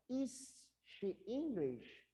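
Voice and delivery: slow, careful question with "Is" first; slow model question. Shown as a demonstration. Teacher slowly models the question for students. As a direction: lay out each word slowly. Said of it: "Peguei o is".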